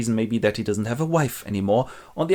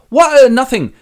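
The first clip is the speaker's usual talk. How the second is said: much higher, louder caught-out squeak; startled yelp; 13 semitones higher, 12 dB louder than usual. Acted. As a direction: jump as if caught. Edmund caught with letter.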